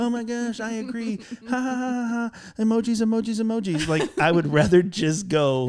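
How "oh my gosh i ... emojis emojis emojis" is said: put-on high, gushing valley girl squeal. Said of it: "you're making me sound like ... a valley girl".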